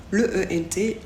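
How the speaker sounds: ordinary talk, nothing marked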